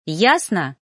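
pointed challenge. A narrator's reading. Trainee challenges her mentor, pointedly. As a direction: press it home as a triumphant question.

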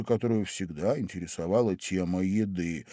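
plain reading voice

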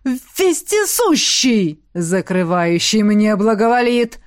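demon lord voice. Acted. Demon lord's ritual invocation.

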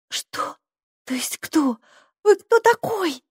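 hesitant start climbing to high pitch; falter, then squeak out the question; stammering. A narrator's reading. Girl gapes at strange little man, stammering. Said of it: "запинаясь, пробормотала Сьюзи".

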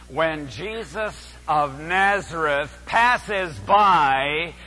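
ordinary talk, nothing marked

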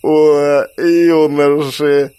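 put-on loud, very slow drunken shout; drunken yell. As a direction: holler it, delighted and drunk. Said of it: "пьяно заорала Антония".

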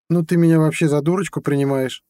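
high, loud offended question; indignant girlfriend. A narrator's reading. Girlfriend offended by his warning. (возмущённо, высоким голосом)

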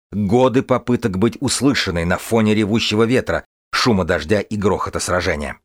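usual speaking voice

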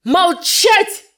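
high, loud furious bark; menacing shout. Acted. Teacher cutting off the insolent girl, a menacing shout. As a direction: roar it to silence the class. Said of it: "прервал Жюли грозным голосом учитель".

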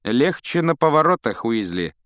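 high, loud start, dropping on the name; mocking warning. A narrator's reading. Malfoy warns Weasley off, mocking. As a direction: warn him with a jeer. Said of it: "предупредил насмешливо Малфой".

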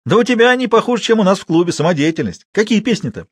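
high-pitched teasing disbelief; amused mockery. A narrator's reading. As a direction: jeer in amusement, pitched well up. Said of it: "Жуков не выдержал и прыснул".

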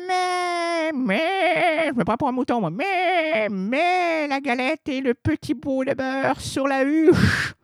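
high, whiny crying voice; whimpering fake granny. Wolf hiding under the blanket whining to the girl, high whimper. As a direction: whine it like a wolf faking a sick granny.

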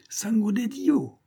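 high, drawn-out exclamation; stretched high-pitched shout without much volume; excited cry. A narrator's reading. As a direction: cry out the discovery, slow and high. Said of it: "cria-t-il".

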